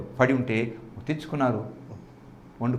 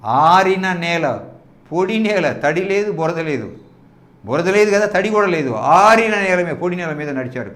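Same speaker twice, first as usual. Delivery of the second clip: emphatic preaching; 3 semitones higher, 5 dB louder than usual. Acted. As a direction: hammer each phrase home to the congregation.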